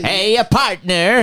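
slow, high, hammed-up Dutch cowboy; a joking Dutch guy pretending to be a cowboy. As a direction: play a Dutchman trying to be a cowboy. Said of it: "A Dutch guy pretending to be a cowboy".

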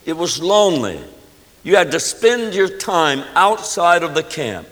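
ordinary talk, nothing marked

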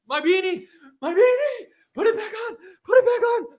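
shrieking YouTuber freaking out over news; Tim Pool impression. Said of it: "this is my Tim Pool impression".

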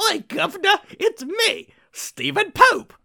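high, squeaky mock Cockney; a hammed-up Cockney greeting, high and loud; Cockney accent. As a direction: ham up a Cockney "oi, governor". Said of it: "Okay, I'm sorry, I can't do this".

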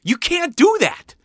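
very high, slow, loud protest; yelled. A narrator's reading. Mom objecting to Dad's plan, yelling. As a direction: draw it out in a high yell. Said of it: "yelled my mom".

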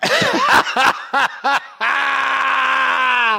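long high-pitched real laugh; big laugh. Real, not acted. Storyteller finishes the soup can tale, big laugh. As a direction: burst out laughing and let it ring.

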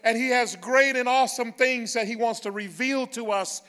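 loud, high, slow preacher's praise; full-force preaching. Acted. Pastor rousing the congregation to praise God, loud and slow. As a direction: lift the room, shouting glory.

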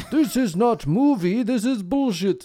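mock Russian accent, slow and high; broken English in a Russian accent. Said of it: "do that accent again".

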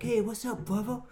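high-pitched imitated American greeting; friendly homeless guy. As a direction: put on a chummy street greeting. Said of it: "there was some homeless guy there".

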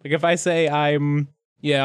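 normal talking voice

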